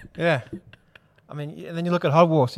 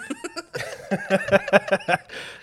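laughing; 6 semitones higher, 3 dB quieter than usual. Real, not acted. Laughing at Hogwarts joke.

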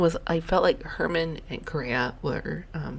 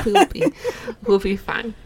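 tipsy, giggling and louder; 6 semitones higher, 10 dB louder than usual. Real, not acted. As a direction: laugh it out a bit tipsy. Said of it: "I'm a little tipsy right now".

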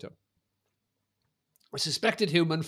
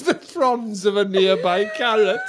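mocking friend; 4 semitones higher, 5 dB louder, slower than usual. Mocking Lloyd's line, laughing.

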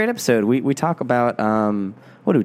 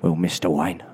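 low Cockney butler voice; low, measured English butler; Michael Caine as Alfred; 6 semitones lower than usual. Pretend. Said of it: "like Michael Caine".

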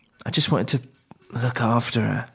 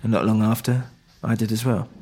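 ordinary delivery

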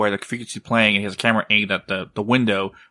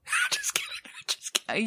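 laughing through the words, much higher; 11 dB quieter than usual. Real, not acted. Husband teasing wife after scaring her, laughing. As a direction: giggle it out as a joke.

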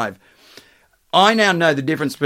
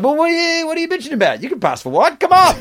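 mocking high voice; 12 semitones higher, faster than usual.

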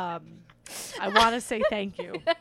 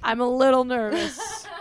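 nervous laugh; 8 dB louder than usual. Real, not acted. Nervous laugh before story.

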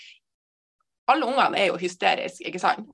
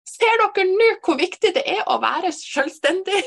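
high, laughing preachy question; mock-proud mom schooling her kids; proud laughing lecture; 9 semitones higher, 3 dB louder than usual. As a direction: lecture the kids through giggles.

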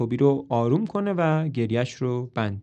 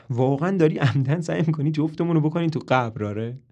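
ordinary delivery, nothing marked

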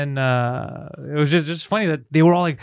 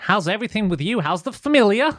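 lilting mock Canadian accent, rising at the end; small talk in a hammed-up Canadian accent; chummy Canadian-guy impression; 4 semitones higher than usual. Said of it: "how many people have that, that accent".

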